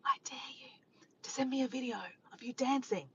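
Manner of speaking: whispered playful dare; hushed mock challenge; teasing whisper.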